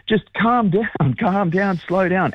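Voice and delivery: high-pitched, urgent plea to settle; swimming coach on sideline. Retold or imitated. Retelling coach reining in a runaway young swimmer, urgent imitation. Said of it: "his coach, Dean Boxall, was on the sideline going".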